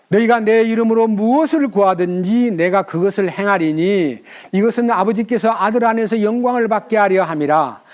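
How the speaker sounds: loud, ringing Bible reading; scripture reading. Acted. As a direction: proclaim the verses to the hall. Reads scripture aloud. (힘차게 성경 낭독)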